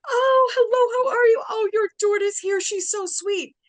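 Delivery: very high, loud, gushing greeting; mock a two-faced nurse's sugary act; fake sweetness. Nasty nurse feigns sweetness to mother.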